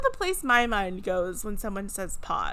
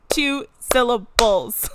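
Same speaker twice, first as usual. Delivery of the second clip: "Two syllables" slow and louder, beat on Two; syllables stressed; 2 semitones higher, 10 dB louder, slower than usual. Shown as a demonstration. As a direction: drive home Two syllables. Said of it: "clap it out for those of you in the back".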